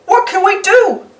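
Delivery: high worried question; Pete worried. Acted. Pete asking Mickey, high and anxious. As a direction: pitch it up, anxious. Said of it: "asks Pete".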